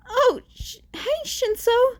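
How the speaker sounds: awkward high-pitched greeting; nervous high voice. A narrator's reading. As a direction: caught off guard, squeak it out. Izuku caught, awkward greeting.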